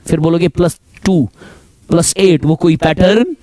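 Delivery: ordinary delivery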